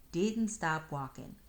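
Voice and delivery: ordinary delivery, nothing marked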